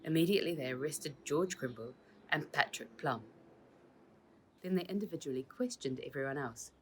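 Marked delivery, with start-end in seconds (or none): none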